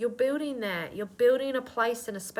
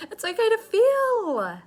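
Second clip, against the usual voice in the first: tearful high cry; 13 semitones higher, 8 dB louder than usual. Real, not acted. Reader choking up while reassuring the viewer, tearful high cry. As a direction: voice breaking up high and loud, near tears. Repeats the line, voice cracking high.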